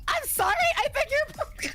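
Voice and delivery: high-pitched mock indignation; incredulous, squeaked-up polite objection; taken aback. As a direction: retell an affronted reply, pitched high. Retells offended reaction to question.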